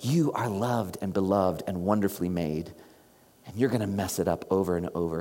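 low, soft, gentle parent's reassurance; tender divine voice. Retold or imitated. God speaking to the congregation, low soft reassurance. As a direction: speak it like a loving parent comforting a child. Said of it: "'cause it says".

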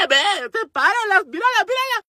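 high, squealed urging; mock-frantic high voice; urgent pleading. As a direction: imitate an excitable girl egging someone on. Twin urges him to intervene.

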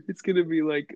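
ordinary talk

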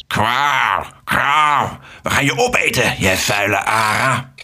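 honking, menacing goose voice; attacking geese. A narrator's reading. Geese swoop on Alex from two sides, honking menace. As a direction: honk it like an angry goose. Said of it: "Het waren een stuk of vier ganzen".

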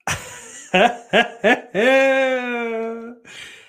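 high staccato laugh ending in a long held note; loud high laugh. Pretend. Streamer filling a silence, loud high drawn-out laugh. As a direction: burst of ha-ha stretched into a high wail.

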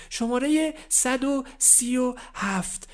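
slow, high announcer call; calling an order number. Acted. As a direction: call the number across the shop.

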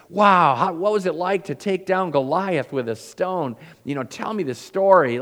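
bright, loud and high, bursting with awe; excited wonder. Pretend. Preacher imagining meeting King David, excited wonder. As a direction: gush like meeting a hero face to face.

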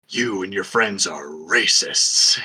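low, slow, ominous villain voice; Jigsaw. Acted. Horror villain condemning a band for racism, low slow menacing imitation. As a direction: intone it slowly and low, like a killer on tape. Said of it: "really funny Jigsaw line where it's like".

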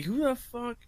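baffled outrage at his dad; incredulous. Retold or imitated. A son baffled by his dad's fake accents, incredulous. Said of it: "And I'm like".

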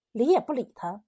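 ordinary delivery

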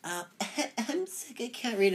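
high, thin mock whine with a laugh; whiny fake-sick classmate. Mocking a classmate who skips reading, high fake-sick whine. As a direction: play a kid faking sick to dodge reading, high and whiny. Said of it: "that one idiot who is like".

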